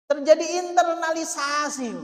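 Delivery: normal talking voice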